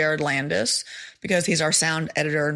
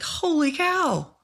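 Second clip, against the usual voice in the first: amazed exclamation; 11 semitones higher, slower than usual. Retold or imitated. Amazed at her photos.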